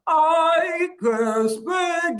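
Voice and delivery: high, very slow robot monotone. Shown as a demonstration. Coach demonstrates for the class, talking like a robot. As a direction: stretch each word out like a robot. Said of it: "I can speak like a robot".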